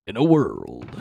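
dramatic movie trailer narrator. Acted. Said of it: "the movie guy voice".

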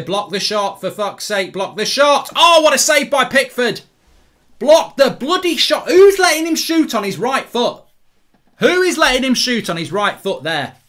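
high, loud exasperated yelling at the players; real shouting. Real, not acted. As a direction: yell in disbelief at sloppy defending. Yelling at defenders during live match.

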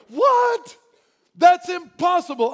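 high, loud incredulous cry; shocked disbelief. Acted. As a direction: shout it like you can't believe it. Incredulous reply voiced.

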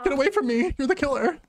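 high-pitched panicked yelp; mock-terrified, high-voiced accusation; playful panic. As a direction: cry out high as you flee. Fleeing the killer in game.

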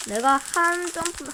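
normal talking voice

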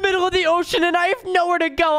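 put-on very high, shouting baby crying for help; high panicked baby voice. Stranded baby shouting to strangers for rescue, high panicked baby voice. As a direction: squeal for rescue like a tiny drowning baby.

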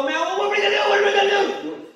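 high pitched frantic mock yell; mock panicked yell. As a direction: play them shrieking back in panic. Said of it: "they'd turn around and yell at me".

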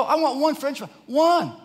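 high, loud, insistent demand; exasperated insistence. Retold or imitated. Dad begs son for one fry, exasperated.

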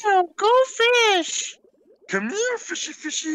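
high sing-song call to a fish; calling a pet. Pretend. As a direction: coax the fish over in a high voice.

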